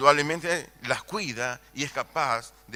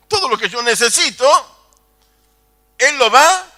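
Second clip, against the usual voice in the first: louder, higher and quick, building to a cry; rising preaching shout; 10 semitones higher, 15 dB louder than usual. Acted. Preacher promising the congregation God provides, shouting. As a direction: lift the promise up to the rafters.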